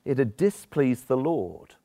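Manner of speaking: "displeased" leaned on slowly and dryly; slow stressed understatement. Shown as a demonstration. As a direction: drag out "displeased" with dry irony. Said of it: "an understatement".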